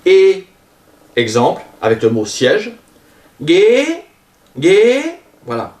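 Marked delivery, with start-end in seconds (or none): 0.1-0.5 "Et" as a pure closed é, high and loud; French é. Shown as a demonstration. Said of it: "pareil que le E accent aigu en français". 3.4-5.2 "ghế" sweeping steeply up to a high pitch; rising tone. Shown as a demonstration. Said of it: "ça monte".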